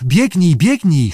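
usual speaking voice

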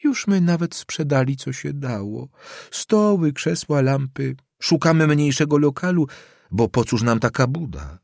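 put-on an aggrieved old landlady pouring out her troubles. Old landlady lists losses.

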